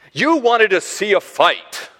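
puffed-up. Acted. Older brother accuses David of fight-watching, puffed-up. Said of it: "he's puffing his chest out at this point".

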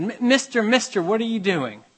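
put-on high, loud eager kid calling out; child's voice. Boy calling to a cathedral builder, high and eager. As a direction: call up to the workman like an eager child.

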